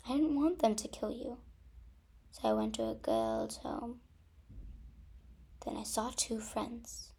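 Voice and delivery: soft, near-whispered storytelling with long pauses; hushed confession. Acted. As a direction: quietly reveal a painful family secret. Sister confesses their sad past.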